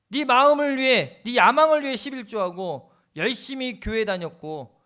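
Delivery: God thunders a rebuke at a proud man; God scolds the selfish believer, loud and stern; God rebuking. Acted. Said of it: "하나님이 음성을 주시더래요".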